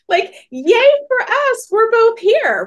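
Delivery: high, loud, slow sing-song cheer; mock cheer. Speaker cheering seeing her rival, high and loud. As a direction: ham up the fake enthusiasm.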